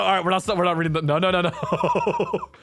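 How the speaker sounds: high-pitched rapid-fire no-no-no; flustered refusal. Real, not acted. Streamer refuses to read the next line, flustered and laughing. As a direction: balk in a high voice, tipping into laughter.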